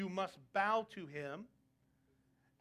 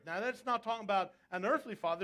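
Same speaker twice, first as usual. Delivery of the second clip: normal talking voice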